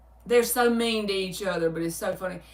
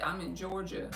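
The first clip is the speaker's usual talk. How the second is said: "Georgia" said much softer, almost to herself; softer repeat; 9 dB quieter than usual. Shown as a demonstration. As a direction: drop the volume way down on "I'm in Georgia".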